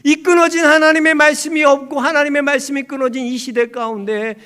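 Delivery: loud, high, rousing proclamation; full-force preaching. Real, not acted. Preacher proclaiming Samuel's calling, loud rousing sermon cry. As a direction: proclaim it with rising urgency, then draw out the close.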